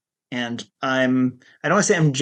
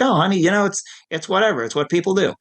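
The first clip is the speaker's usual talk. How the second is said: higher-pitched, badly imitated Long Island accent; amateur Long Island accent, pitched up; Lee's Long Island accent; 6 semitones higher than usual. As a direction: imitate a therapist shrugging it off in bad Long Island. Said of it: "I'm doing a terrible imitation of her accent".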